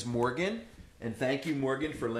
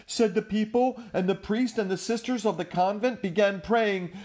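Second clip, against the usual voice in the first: put-on lively read-aloud to children; animated storyteller voice; storybook narration; 4 semitones higher, 6 dB louder than usual. A narrator's reading. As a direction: tell it big for the little ones. Reading picture book aloud.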